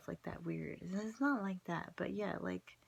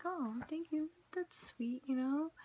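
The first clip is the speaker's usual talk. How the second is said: higher, coy and flattered; flattered thanks; 9 semitones higher than usual. Retold or imitated. Herself thanking the psychic for a compliment, flattered. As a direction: lift the pitch, pleased and a little bashful.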